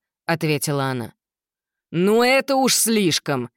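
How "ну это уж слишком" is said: slow, high outraged protest; indignant. Acted. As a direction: huff with indignation. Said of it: "возмутился Гектор".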